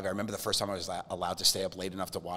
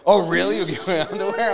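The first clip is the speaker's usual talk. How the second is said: high, loud mock amazement; retold surprise; 10 semitones higher, 11 dB louder than usual. Comedian imitates magician offered underwear, mock surprise. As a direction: act out someone amazed by a yes.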